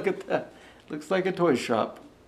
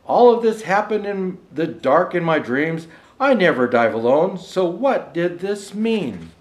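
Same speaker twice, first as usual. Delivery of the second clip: measured, rhythmic storybook reading; lilting holiday verse reading; poem recitation; 7 dB louder than usual. Acted. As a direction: read it like a bedtime Christmas rhyme. Rhymed Christmas poem read aloud.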